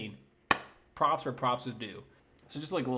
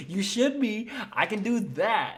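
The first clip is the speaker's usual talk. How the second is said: much higher and louder, cocky and showy; hammed-up boast; 9 semitones higher, 5 dB louder, slower than usual. Edward showing off to Bella, loud and cocky. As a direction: belt it out like a show-off vampire. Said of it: "That would've been so funny".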